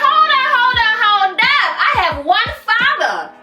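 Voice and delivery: high-pitched mock-indignant retort; indignant comeback. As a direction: cut him off in a high voice. Acted comeback to the angry man.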